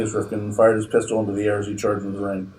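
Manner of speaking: plain reading voice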